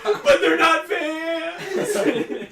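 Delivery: high, loud, drawn-out fake wail; exaggerated slow sobbing protest; mock crying. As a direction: bawl it like an upset kid, hammed up. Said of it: "I started to cry".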